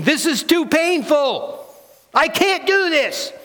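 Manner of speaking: high-pitched cry of being overwhelmed; anguished inner voice. Acted. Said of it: "my inner broken voice is saying".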